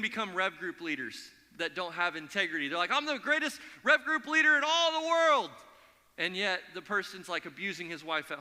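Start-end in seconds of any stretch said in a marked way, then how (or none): 2.9-5.5 mock boast. Mocking a boastful leader.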